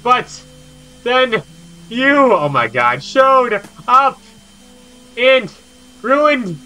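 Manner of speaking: high, loud, theatrical ranting villain; dramatic villain rant. Acted. A villain raging at the one who ruined everything, high and loud. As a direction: belt out the hateful speech far above your own voice. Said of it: "that took a lot of energy to read all that".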